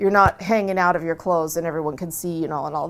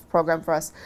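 ordinary talk, nothing marked

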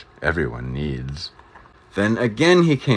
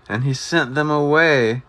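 ordinary delivery

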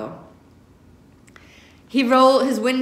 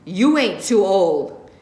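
growl; 4 dB louder, slower than usual. Acted. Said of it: "and growls".

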